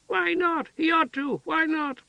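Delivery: very high, pleading simple-woman voice; insistent childlike questions. A narrator's reading. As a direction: ask like a stubborn child. Heather asks childishly.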